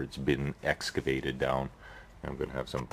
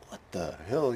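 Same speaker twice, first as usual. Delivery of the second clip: higher-pitched mock bafflement; startled reaction; 5 semitones higher than usual. Man hearing a noise while working, acted startled. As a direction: act out being puzzled by a sudden noise.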